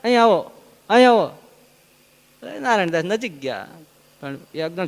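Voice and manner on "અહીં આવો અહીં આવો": calling a man over, loud and high; a villager calling out to a stranger. Retold or imitated. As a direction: hail him from a distance. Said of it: "એટલે એને કીધું".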